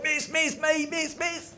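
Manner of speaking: high-pitched schoolkid begging to be picked; eager pupil. Acted. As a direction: squeal for the teacher's attention.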